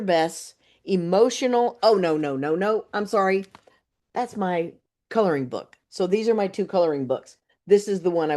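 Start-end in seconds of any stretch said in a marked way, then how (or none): none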